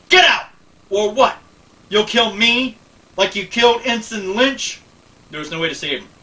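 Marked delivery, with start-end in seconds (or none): 0.1-0.5 furious shout. Acted. Picard ordering Lily out in a furious shout. 0.9-4.8 loud, high, taunting comeback; angry retort. Acted. Lily taunting Picard about Ensign Lynch, loud and angry. Lily fires back in argument.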